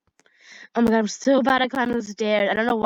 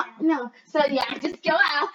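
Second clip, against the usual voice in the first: normal talking voice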